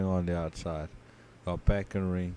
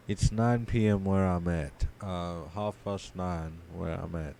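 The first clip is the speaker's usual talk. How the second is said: ordinary talk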